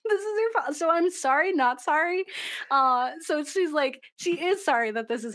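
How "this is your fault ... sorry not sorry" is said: sassy mock rant.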